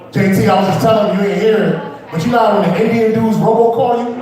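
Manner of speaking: ordinary talk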